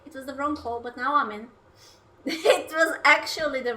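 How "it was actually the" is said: laughing. Real, not acted. Laughing about the mistake.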